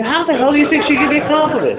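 high, loud, fast exasperated retort; indignant outburst. Retold or imitated. Retelling his angry reply to his friend, high and loud. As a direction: fire it back in disbelief.

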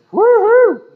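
high, loud woo-hoo; celebratory party whoop; excited whoop. Retold or imitated. Acts out the drug high.

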